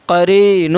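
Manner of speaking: "قرینہ" with long ee and long final oo; drawn-out recitation. Shown as a demonstration. Quran teacher modelling the word, "قرینہ" drawn out. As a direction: hold the ee and closing "hu" of "قرینہ". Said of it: "یا مدہ کو ایک الف کے برابر کھینچ کر پڑھیں".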